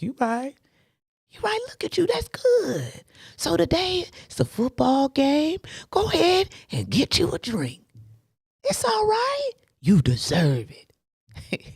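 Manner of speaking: high, sly coaxing with a whispered aside; the devil on his shoulder coaxing; coaxing tempter voice. Retold or imitated. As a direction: sweet-talk him toward the drink. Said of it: "listening to the voice like".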